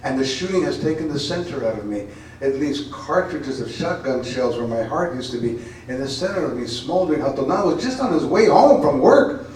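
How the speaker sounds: dramatic spoken-word poem, building to loud cries; poem reading. Acted. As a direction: perform the poem with rising passion. Reads her poem aloud.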